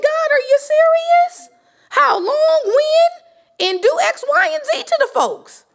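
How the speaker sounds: high, loud, breaking cry of the sufferer; anguished cry to God. Retold or imitated. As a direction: cry out to God like one who has suffered too long. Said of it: "everyone who suffered screamed".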